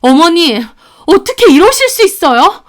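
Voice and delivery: high, loud, wounded disbelief; aghast, pitch jumping up; shocked protest. Acted. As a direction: protest in shock at being betrayed. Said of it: "제가 충격받아서 말하자".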